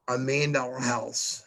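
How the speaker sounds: ordinary talk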